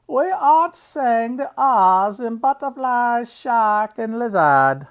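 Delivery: high, loud, hammed-up English royal accent; terrible Queen accent. Uncle playing the Queen of England, terrible royal accent. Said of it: "That's a terrible Queen accent".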